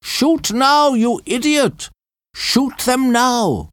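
high desperate yell, wounded; shouting while wounded. A narrator's reading. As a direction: cry out in pain and panic. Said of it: "Brunt cried out to von Lansen".

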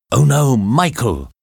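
distressed cry. A narrator's reading. Pilot finding his co-pilot dead, dismayed cry. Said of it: "John cried".

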